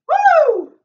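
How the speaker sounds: loud triumphant whoop. Real, not acted. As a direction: whoop with relief. Whoop after finishing set.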